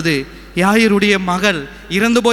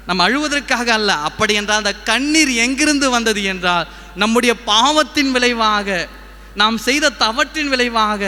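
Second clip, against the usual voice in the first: preaching; 4 semitones higher than usual. Acted. Preacher explains to congregation why tears exist, preaching. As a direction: proclaim it in measured pulpit phrases. Preaching, raised above his chat.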